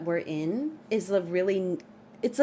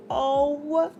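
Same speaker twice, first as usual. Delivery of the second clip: surprised oh; 6 semitones higher, 4 dB louder than usual. Real, not acted. Reacts to a roast from chat.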